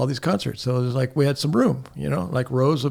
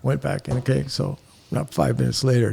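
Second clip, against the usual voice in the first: ordinary delivery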